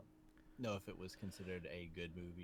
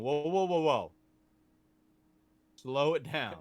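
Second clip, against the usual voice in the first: loud, higher-pitched "whoa" of alarm; loud mock protest; 4 semitones higher, 11 dB louder than usual. Darth Vader telling the bully to back off, loud mock protest. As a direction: call a halt, hammed-up and loud.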